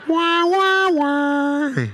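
high, loud wailing wah; mock crying. Teammates mocking him with a baby cry. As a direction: fake baby whimper. Imitated crying in silent room.

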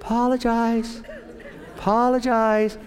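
high, gentle repeated urging; voice of God prompting. Acted. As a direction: inner voice telling a child to say sorry. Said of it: "you hear somebody saying".

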